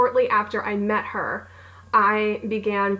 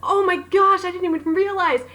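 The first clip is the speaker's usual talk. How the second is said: high, louder, thrilled; fan's giddy realization, high and loud; excited squeal; 9 semitones higher, 4 dB louder than usual. Retold or imitated. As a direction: burst out in giddy disbelief. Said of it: "I go".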